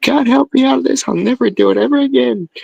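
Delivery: high, rushed, whimpering promises; frantic begging. Retold or imitated. Stoned son frantically promises his mom never again. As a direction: swear you'll never do it again, near tears.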